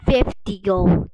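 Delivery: slow, loud stunned yell; loud outrage. Real, not acted. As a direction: yell the price in disbelief. Outraged at item price.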